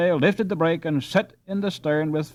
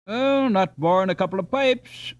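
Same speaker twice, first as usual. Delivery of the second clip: put-on gruff old skipper; 6 semitones higher, 4 dB louder than usual. A narrator's reading. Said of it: "replied a voice rising from smoke".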